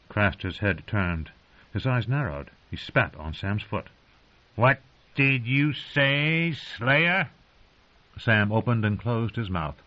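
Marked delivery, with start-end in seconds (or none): 4.6-7.3 menacing wildling. A narrator's reading. Wildling threatening Sam slowly.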